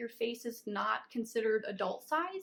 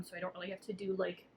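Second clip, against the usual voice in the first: ordinary talk, nothing marked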